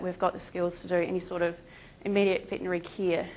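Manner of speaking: ordinary talk, nothing marked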